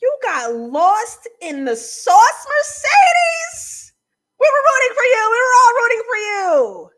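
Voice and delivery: high, loud, outraged mock yell; hammed-up scolding. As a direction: holler your disappointment theatrically.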